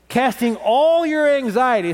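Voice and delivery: "all" drawn out slow and high with a laugh; emphatic scripture with a laugh; stress on all. Shown as a demonstration. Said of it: "say all of it".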